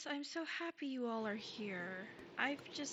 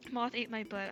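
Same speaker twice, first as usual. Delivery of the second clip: usual speaking voice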